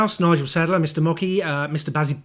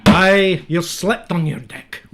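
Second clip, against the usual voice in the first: mock Scottish accent; 3 semitones higher, 7 dB louder, slower than usual. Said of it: "if only I'd used a Scottish accent".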